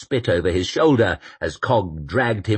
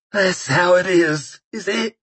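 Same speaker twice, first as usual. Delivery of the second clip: put-on bitter old man; 11 semitones higher, 3 dB louder than usual. A narrator's reading. Koska bitter.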